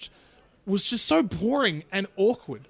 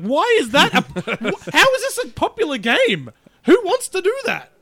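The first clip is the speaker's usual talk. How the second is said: exasperated disbelief, rising louder; incredulous; 9 semitones higher, 5 dB louder, slower than usual. Retold or imitated. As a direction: act baffled by the boring game. Mocking the game he watched.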